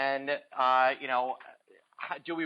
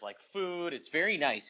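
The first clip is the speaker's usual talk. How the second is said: ordinary delivery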